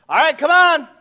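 loud high impatient call; parent calling. Retold or imitated. Preacher imitates calling his kids to come, loud and high. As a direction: hurry the kids along.

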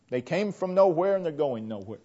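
ordinary talk

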